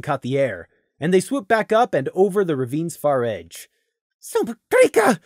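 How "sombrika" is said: high, panicked cry; panicked outburst. Acted. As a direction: blurt it out in sudden panic. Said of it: "realized in panic".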